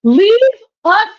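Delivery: indignant rant. Real, not acted. Ranting at K-pop groups.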